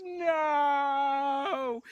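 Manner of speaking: very long, loud, high wail; milk it as an endless melodramatic howl; crying to heaven. Acted. Romeo cries to heaven in grief, endless hammed-up wail. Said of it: "raises his arms to heaven and cries".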